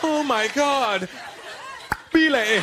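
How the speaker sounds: high, drawn-out mock passion; hammed-up high ecstatic cry; woman in ecstasy. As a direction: imitate a lover crying out the name. Woman answering in acted love scene.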